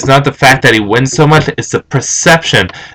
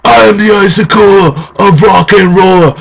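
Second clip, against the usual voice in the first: lightly hammed-up wrestler boast, higher; wrestler's promo; 7 semitones higher than usual. Fan mimicking wrestlers bragging about themselves, lightly hammed-up promo. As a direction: proclaim it like a wrestler in the ring.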